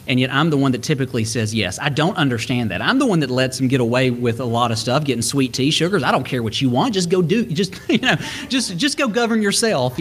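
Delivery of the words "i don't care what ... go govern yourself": higher, chuckling through the words; easygoing parent, breaking up laughing; amused indulgent parent. Retold or imitated. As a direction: laugh while waving the kids off. Dad indulging his kids, breaking into laughter.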